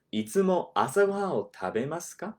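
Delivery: "いつも朝ごはんを食べますか?" said quickly at native pace; 自然な速さで; natural speed. Shown as a demonstration. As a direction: say it fast, the way a native would. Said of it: "I'll do it slowly".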